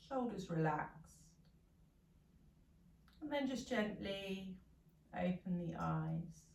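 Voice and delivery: soft, low meditation voice; guided meditation. Real, not acted. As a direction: guide them gently through the last breaths, softly. Leads a meditation.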